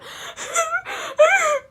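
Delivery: high mock sobbing wail; imitated sob. Showing how she sobbed in the movie theater, mock sob. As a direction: fake a muffled sob. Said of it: "trying to stop sobbing ... sitting there going".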